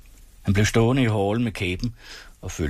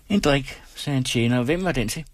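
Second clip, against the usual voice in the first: lightly voiced waiter, pitched higher; a waiter serving, a touch higher; 7 semitones higher than usual. A narrator's reading. (tjenerstemme, lidt lysere)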